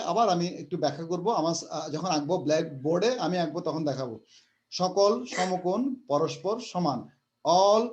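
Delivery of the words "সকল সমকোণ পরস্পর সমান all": very slow, weighted repeat of সকল সমকোণ পরস্পর সমান; teacher repeating the rule slowly to memorise; slow dictation. Shown as a demonstration. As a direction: lean on every word so it sticks. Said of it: "মনে রাখবা".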